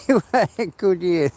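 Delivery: ordinary talk, nothing marked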